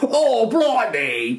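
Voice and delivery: high, drawn-out hammed-up British exclamation; mock British accent. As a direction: put on a silly British voice, slowly. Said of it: "I don't even think she was British".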